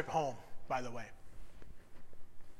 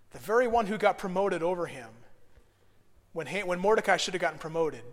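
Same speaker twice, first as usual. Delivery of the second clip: ordinary talk, nothing marked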